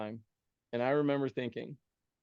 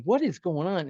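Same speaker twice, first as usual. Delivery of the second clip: raised, louder bewildered cry; incredulous outburst, pitched up; retold alarm; 5 semitones higher, 6 dB louder than usual. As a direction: act out baffled panic. Acted panic at toenail clipping.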